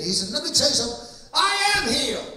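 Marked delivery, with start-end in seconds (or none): 0.3-1.3 firm, louder, rising to a challenge; bold indignant. Retold or imitated. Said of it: "he got real bold and he got indignant". 1.3-2.3 high, loud, defiant insistence; indignant declaration. Retold or imitated. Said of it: "he got real bold and he got indignant".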